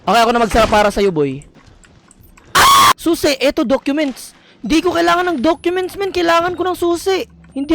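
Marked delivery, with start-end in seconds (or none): 2.5-2.9 short, piercing high shriek; startled scream. Real, not acted. Gamer startled while searching, screaming. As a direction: scream suddenly and loudly. Jump scare in game.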